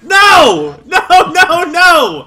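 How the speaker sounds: high, loud repeated refusal; frantic high-pitched protest; desperate shouting. Real, not acted. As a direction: yell each one in dismay. Fails again in game.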